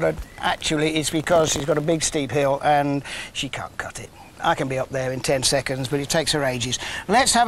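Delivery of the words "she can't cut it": conspiratorial whispered aside; a cheeky stage whisper to the viewers. Real, not acted. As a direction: whisper it behind her back, teasing. Teasing aside about absent co-host.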